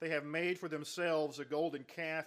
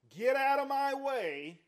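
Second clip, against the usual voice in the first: much higher and louder slow rebuke, out rising; God's fury; 8 semitones higher, 10 dB louder, slower than usual. Acted. Preacher imagining God banishing him for failing, loud. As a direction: blast out of my way as an angry God. God rejecting failed Ben.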